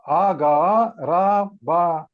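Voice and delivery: A ga ra ba spelled slowly, syllable by syllable; careful slow spelling. Shown as a demonstration. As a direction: spell out "A ga ra ba" deliberately. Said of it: "What is the spelling?".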